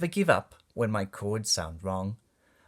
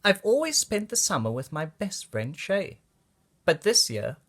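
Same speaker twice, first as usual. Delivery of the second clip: plain reading voice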